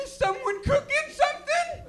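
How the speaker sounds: very high, puzzled sniffing question; oblivious horn guy. Retold or imitated. Horn guy missing the fire, very high and puzzled. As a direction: sniff the air, clueless.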